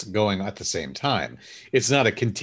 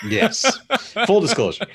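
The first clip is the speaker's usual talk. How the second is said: high, loud laugh through words; laughing shout; 12 semitones higher, 4 dB louder than usual. Real, not acted. Laughing after the joke.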